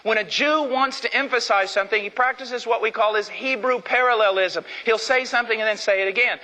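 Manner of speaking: ordinary delivery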